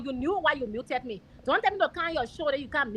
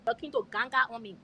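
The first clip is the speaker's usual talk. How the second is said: normal talking voice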